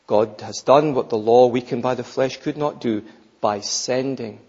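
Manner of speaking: ordinary talk, nothing marked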